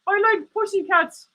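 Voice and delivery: high squeaky meerkat puppet; high puppet voice for Alan. Acted. Streamer voicing his meerkat puppet, high and cheeky.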